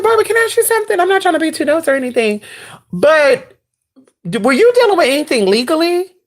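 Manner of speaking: high, quick, eager questioning; excited probing. Retold or imitated. Storyteller asks Barbara about legal trouble after flashback, excited. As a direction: tiptoe into the question, pitched high with urgency.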